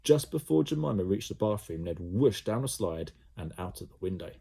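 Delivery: plain reading voice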